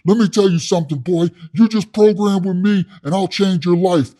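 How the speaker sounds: loud, high hard-sell from an inmate; amped-up cellmate. Retold or imitated. Inmate promising to change your life, amped. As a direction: imitate a forceful self-styled guru. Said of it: "this dude's freaking amped".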